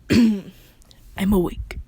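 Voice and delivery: loud, slow, rough just-woke-up voice; loud groggy awake. Real, not acted. Narrator jolting awake mid-story, loud and slow. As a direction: bellow it out slowly after clearing the throat. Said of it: "Did I say awake?".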